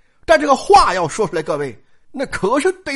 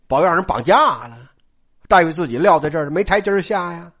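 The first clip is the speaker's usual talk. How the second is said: plain reading voice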